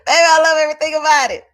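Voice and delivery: loud, laughing through the words; laughing shout. Real, not acted. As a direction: burst out laughing while talking. Laughing hard over a quote.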